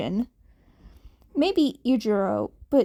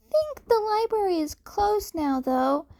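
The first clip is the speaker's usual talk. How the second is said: much higher, keen; eager kid Deku; 8 semitones higher than usual. A narrator's reading. Cat kid offering his Google Doc, high and eager.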